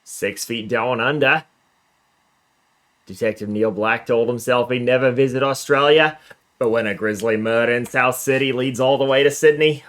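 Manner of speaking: hammy attempt at an Australian accent. Player reading a detective novel blurb, amateur Australian accent. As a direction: read it out in a put-on Australian accent. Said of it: "That is my best Australian accent".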